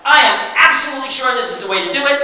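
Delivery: high, fast, utterly certain; overconfident know-it-all. Shown as a demonstration. Presenter playing a direct person, brash and certain. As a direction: brush off any doubt. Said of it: "Makes emphatic statements".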